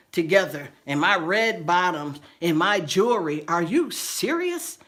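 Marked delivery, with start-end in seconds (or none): 3.5-4.8 high, slow disbelief; incredulous. Real, not acted. Speaker to viewers about neighbors packing heels, slow high disbelief. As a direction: stretch it out in shock.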